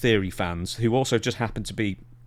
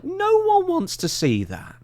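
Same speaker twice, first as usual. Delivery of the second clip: scoffing; slower than usual. Real, not acted. Mocking scoff, very high.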